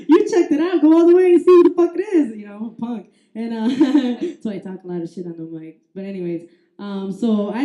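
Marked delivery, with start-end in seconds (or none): 0.1-2.4 loud, high, quick-fire bickering; re-enacted cowardly bossing; defiant order. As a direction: shove the job onto someone else. Acted quarrel with girlfriend.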